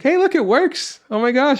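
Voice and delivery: very high, excited imitation of a mom; delighted mom. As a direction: squeal it like a thrilled mother. Voices delighted mom when it works.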